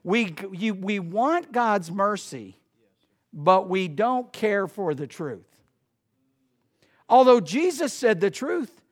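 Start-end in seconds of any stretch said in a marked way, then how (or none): none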